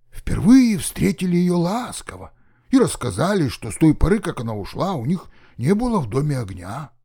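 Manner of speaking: ordinary delivery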